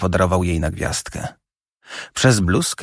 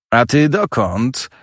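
slow teasing taunt; mocking challenge; 3 semitones higher, slower than usual. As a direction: bait her, drawn out. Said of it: "prowokowałem".

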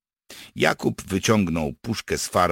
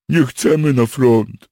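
loud, high, slow wail; moaning; 9 semitones higher, 12 dB louder than usual. Acted. Captured soldier dreads returning to the front, moaning. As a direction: wail it out slowly in protest. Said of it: "jęknął Freder".

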